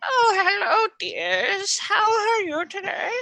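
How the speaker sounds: put-on very high, drawn-out old lady voice; little old lady. Shown as a demonstration. Game master demonstrating a favourite character, little old lady. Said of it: "is the little old lady".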